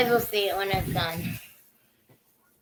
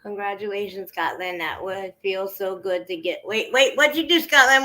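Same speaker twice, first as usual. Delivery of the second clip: ordinary talk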